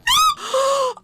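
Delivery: loud, high gasp of shock; sharp shouted gasp. Real, not acted. Streamer getting hit in the game, loud shocked gasp.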